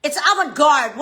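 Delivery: ordinary talk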